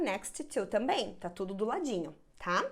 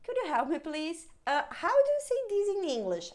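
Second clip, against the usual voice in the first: polite tourist asking; 5 semitones higher than usual. Pretend. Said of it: "pede pra própria pessoa".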